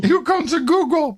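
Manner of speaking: high, loud grand voice; mock announcer. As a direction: boom it like an announcer. Repeats in bigger voice.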